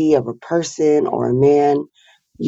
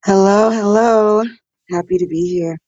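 hoarse, just-woke-up voice; a raspy voice, rough from the morning; 7 semitones higher than usual. Real, not acted. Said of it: "Excuse my raspy morning voice".

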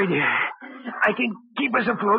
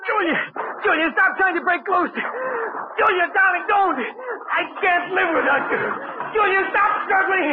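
high, frantic calls to his struggling wife; frantic pleading; 7 semitones higher, slower than usual. Acted. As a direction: beg her to stop, panicking.

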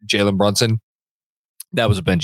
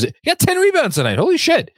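surprised. Real, not acted. Surprise at stat check.

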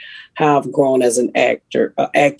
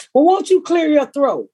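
higher-pitched mimicked voice of the daughter; imitating a daughter; 11 semitones higher than usual. Daughter asking Miss Pat about her morning voice, imitated high. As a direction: quote her in a high, put-on voice. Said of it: "Your voice is so raspy".

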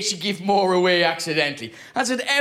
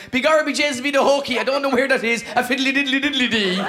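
hammed-up stage-Irish babble, pitched high; 4 semitones higher than usual. Irishman playing foreign to dodge police, hammed-up Irish. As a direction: lay on a mock Irish accent, lilting. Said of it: "I did what any Irish person does abroad".

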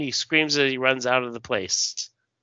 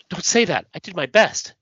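higher-pitched, pained protest; acted pleading; 7 semitones higher, 3 dB louder than usual. Failed hero answers Larissa's accusation, acted plea. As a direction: plead in self-defence, melodramatically.

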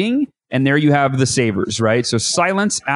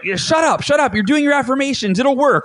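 high, insistent mock self-scolding; shushing the doubt; 10 semitones higher than usual. Telling your own doubt to shut up, high and insistent. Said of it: "And then you're like".

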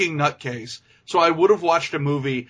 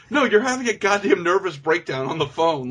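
ordinary delivery

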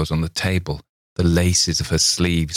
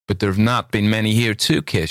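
lightly voiced naive girl, a touch higher; exclaiming naively; 5 semitones higher, faster than usual. A narrator's reading. Miss Thayer answers Campbell's accusation, naive exclamation. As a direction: say it innocently. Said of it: "she exclaimed naively".

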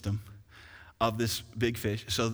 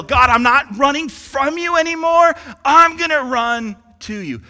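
loud, high plea to God; Jonah crying out; 11 semitones higher, 13 dB louder than usual. Acted. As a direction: Jonah cries out from the whale's belly. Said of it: "This is what Jonah does".

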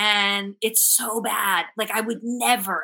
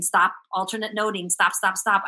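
ordinary delivery

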